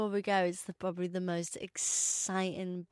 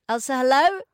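excited greeting; 3 semitones higher, 9 dB louder than usual. Acted. Greeting guest on phone.

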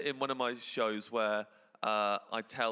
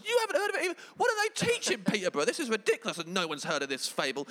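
much higher, louder disbelief; mock incredulous; 10 semitones higher, 4 dB louder, faster than usual. Comic acting stunned that nobody knows the fable, high mock disbelief. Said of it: "I was like".

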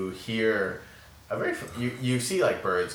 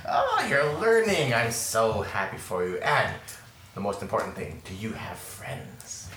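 put-on old woman mentor. Old mentor happy for learning pupil, old woman's voice. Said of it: "Your, your mentor".

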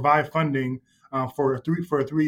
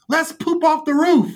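high, drawn-out loud call; imitated kid's call-out; 13 semitones higher, 5 dB louder, slower than usual. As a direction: holler it like a camper plotting mischief. Acting out a camper's gleeful call.

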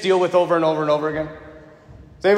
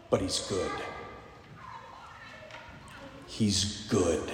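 reverent, softer close; hushed; 13 semitones lower, 17 dB quieter, slower than usual. Acted. The beaver telling Susan, hushed and reverent. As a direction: drop to a near hush on good.

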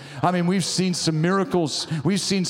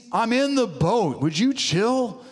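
higher, drawn-out casual complaint; mock exasperation; 7 semitones higher, slower than usual. Jesus to panicking disciples in the boat, mock-exasperated. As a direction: playfully voice someone woken from a nap.

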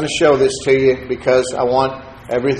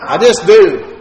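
mock Hebrew answer; 3 semitones higher than usual. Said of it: "How you say that in Hebrew?".

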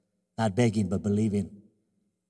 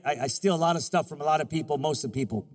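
usual speaking voice

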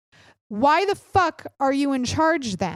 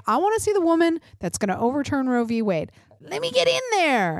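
fast, climbing to a much higher excited plea; eager Pence; 4 semitones higher, faster than usual. Retold or imitated. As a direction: gush like a fan dying to get in. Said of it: "He's like".

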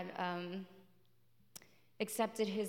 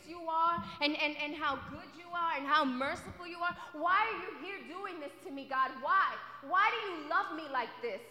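higher, pleading, voice strained with feeling; anguished pleading; 6 semitones higher than usual. Retold or imitated. Preacher reliving his prayer, crying out why God loves him. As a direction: cry out to God in disbelief. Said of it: "I was just like".

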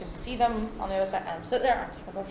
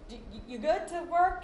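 high, stammering concern; retold hesitant check from a partner; imitating concerned partner; 8 semitones higher than usual. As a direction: stumble into the question, pitched up. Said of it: "He's like".

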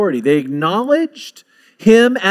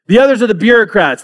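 ordinary delivery

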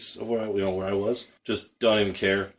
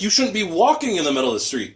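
high, argumentative comeback; indignant retort; 9 semitones higher, 3 dB louder than usual. Retold or imitated. Rider arguing back at woman in street, indignant retort. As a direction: throw it back at her, voice raised in pitch.

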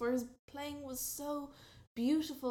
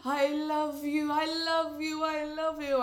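loud lovesick pleading; 3 semitones higher, 8 dB louder than usual. Acted. Francesco pleads love.

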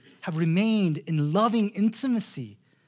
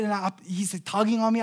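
usual speaking voice

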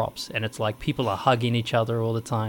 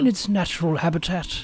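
wildlife narrator voice, higher pitched; nature documentary; 6 semitones higher than usual. Pretend. Parodies athletes as wildlife.

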